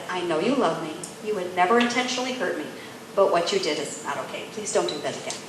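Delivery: low, gentle, measured firmness; calm, respectful. Retold or imitated. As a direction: set a boundary tenderly, softening toward the end. Repeats her calm respectful boundary.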